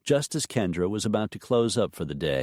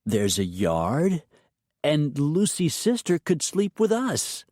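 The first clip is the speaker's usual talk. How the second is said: put-on high hopeful child pleading; small girl building her argument; little girl voice; 8 semitones higher than usual.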